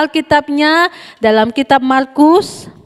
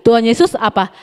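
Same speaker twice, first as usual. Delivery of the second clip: ordinary talk, nothing marked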